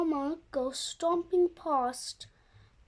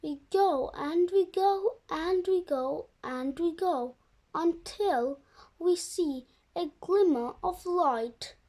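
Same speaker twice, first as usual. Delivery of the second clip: usual speaking voice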